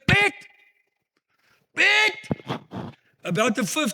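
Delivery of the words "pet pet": high, loud call out the window; shrill-pitched shouting of a name; retold scream. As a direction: yell his name up at the neighbor's house. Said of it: "she screamed".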